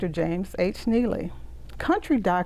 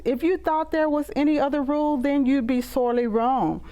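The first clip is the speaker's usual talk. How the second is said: lively, proud recitation with a rhythmic lilt; poem reading; 4 semitones higher than usual. Acted. Poet reciting her poem about Partlow's marching band, proud lively recitation.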